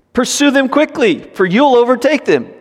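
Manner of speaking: high, pressing voice, pushing the pursuers away; urgent false tip. Acted. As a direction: sell the lie with urgency. Rahab's lie voiced high.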